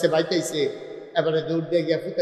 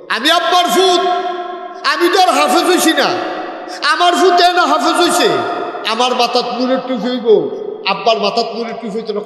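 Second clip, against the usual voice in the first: son's plea; 7 semitones higher, 5 dB louder than usual. Acted. Son voiced pleading with father.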